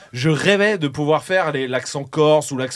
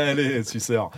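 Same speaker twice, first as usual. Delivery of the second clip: ordinary talk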